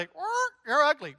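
high squawky parrot voice calling "Er, you're ugly"; squawking parrot. Acted. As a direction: squawk it like a rude bird. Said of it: "this parakeet goes".

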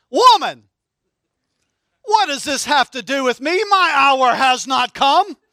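put-on a teen loudly sassing his mom with Jesus' words; sassy back talk. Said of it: "Don't try this at home on your mom".

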